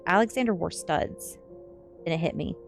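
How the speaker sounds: plain reading voice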